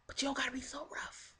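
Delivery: breathy whispered aside. Retold or imitated. Mock advice to Kimsha, whispered. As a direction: drop to a whisper for the last bit.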